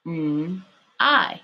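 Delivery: slow phonics split, MM, pause, I; sounding out. Shown as a demonstration. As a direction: break the word into MM and long I. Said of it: "what sound does the Y make".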